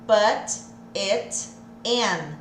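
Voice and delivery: slow, each T in "Put it in" clear; slow careful put it in. Shown as a demonstration. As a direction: separate the words of "Put it in". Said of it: "ao invés de eu falar put it in". ("Put it in" devagar, separado)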